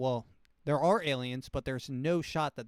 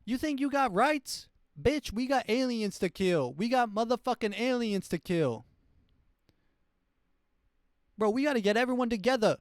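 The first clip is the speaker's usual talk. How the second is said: much higher, louder hammed-up tirade; ranting president; 10 semitones higher, 6 dB louder than usual. President in twenty thirty-eight ranting about aliens, high loud yell. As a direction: rant like a president losing it over aliens. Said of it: "why he's talking like that".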